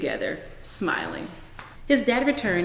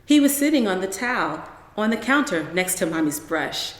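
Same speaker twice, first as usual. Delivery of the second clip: usual speaking voice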